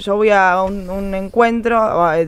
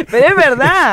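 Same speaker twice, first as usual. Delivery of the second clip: high, loud, laughing agreement; amused outburst, pitched up through real laughter; 4 semitones higher, 5 dB louder than usual. Real, not acted. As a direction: burst out laughing as you agree. Laughs at the quoted boxing line.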